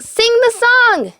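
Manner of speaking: high, loud furious command; Anger orders them to sing, loud and furious; angry shout. A narrator's reading. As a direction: bark it like Anger losing patience. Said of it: "shouted Anger".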